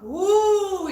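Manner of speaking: high, loud, drawn-out woo; startled whoop. Acted. Reader sensing bristled hackles, sharp high whoop. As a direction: whoop as if hackles just shot up.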